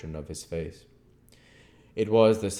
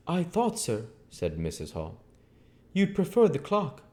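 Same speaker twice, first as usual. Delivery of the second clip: higher, lively innkeeper's wife; landlady voice; 12 semitones higher, 4 dB louder than usual. Acted. Landlady asking the guest's leave, higher voice. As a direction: voice Mrs Hall, pitched up and eager.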